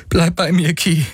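high, urgent begging; desperate plea. A narrator's reading. Galen to dying Ki, begging her to stay.

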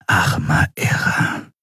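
slow, stunned whisper; hushed, dismayed murmur; aghast whisper. A narrator's reading. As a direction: whisper it in shock. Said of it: "flüsterte Shiar entgeistert".

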